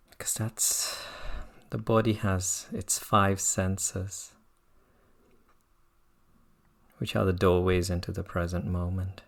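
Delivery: unhurried, with long silences between phrases; guided meditation. Real, not acted. Meditation teacher settling students into practice, calm guiding voice. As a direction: guide them gently into the present moment.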